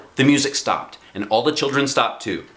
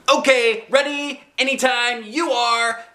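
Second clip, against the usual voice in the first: yelled; 14 semitones higher, slower than usual. A narrator's reading. Junie B yelling to the cake lady, high and eager. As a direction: shout it out, impatient to start. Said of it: "I yelled".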